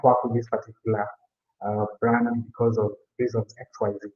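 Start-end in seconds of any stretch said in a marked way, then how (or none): none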